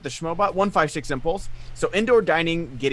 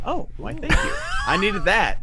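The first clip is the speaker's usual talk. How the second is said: much higher, coy mock-flattered thanks; 7 semitones higher than usual. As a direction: play the flattered star, batting the compliment back. Reading flattering chat comment.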